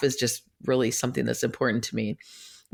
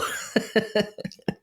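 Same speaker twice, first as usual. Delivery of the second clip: high, light chuckle; soft breathy giggle; amused laugh; 7 semitones higher, 3 dB quieter than usual. Real, not acted. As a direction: laugh at your own joke. Laughs at own joke about husband.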